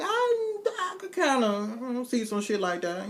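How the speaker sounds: high, halting, giggly; flustered younger self. Retold or imitated. High flustered answer to Joker's suggestion. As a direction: stammer out the answer.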